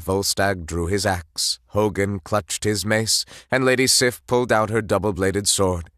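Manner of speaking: usual speaking voice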